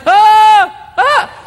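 loud, high two-note holler; high holler call. Shown as a demonstration. Husband hollering for his wife at a craft show, loud high call. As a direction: holler to find her in the crowd. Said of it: "I would holler".

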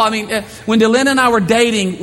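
ordinary talk

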